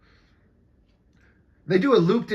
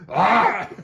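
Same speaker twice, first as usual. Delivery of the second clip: mock scream; 5 semitones higher than usual. Mock scream then laugh.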